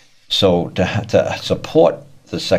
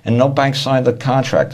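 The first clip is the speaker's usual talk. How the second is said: higher, indignant mocking voice of the folks; mock protest from folks; 7 semitones higher than usual. Debtor telling the speaker the bank never signed, mock indignant and higher. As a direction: whine it back like a clueless customer. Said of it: "they say".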